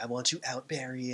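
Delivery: usual speaking voice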